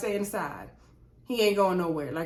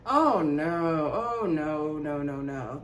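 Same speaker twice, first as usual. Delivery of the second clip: a disgusted reaction that fades from slow disbelief to a low murmur; fading disbelief; 7 semitones lower, much slower than usual. Real, not acted. Reacting to the "bird" insult.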